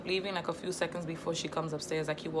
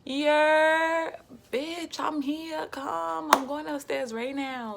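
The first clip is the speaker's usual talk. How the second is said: much higher, much louder street yell; yelling out the window; 11 semitones higher, 7 dB louder, much slower than usual. Retold or imitated. Cousin yelling up from the street, loud and high. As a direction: shout it up from below the window. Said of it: "all I hear out the window is".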